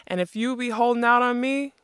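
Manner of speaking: mayor shouting threats at the giant outlaw. A narrator's reading. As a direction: roar it at the outlaw. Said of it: "he shouted".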